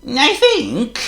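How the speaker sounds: a chirpy, fussy bird; high loud blackbird voice; Charles the Blackbird. A narrator's reading. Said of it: "continued Charles".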